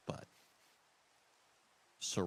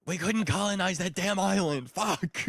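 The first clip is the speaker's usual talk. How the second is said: mock colonizer; 9 semitones higher, 9 dB louder than usual. Playing British colonizer, frustrated.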